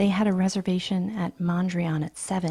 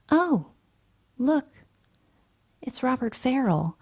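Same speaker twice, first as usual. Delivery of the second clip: bright surprise; 7 semitones higher than usual. Acted.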